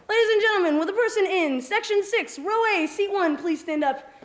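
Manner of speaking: high, projected circus announcer; head clown announcing. Acted. Lead clown picks Kenny from the crowd, announcing. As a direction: call out to the whole big top. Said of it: "He says".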